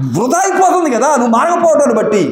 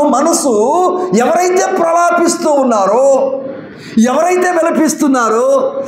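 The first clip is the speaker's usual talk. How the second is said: higher, driving pulpit voice; full-force sermon, pitched up and pressing; impassioned preaching; 5 semitones higher than usual. Acted. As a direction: preach it with rising fervour. Preacher rises into fervour.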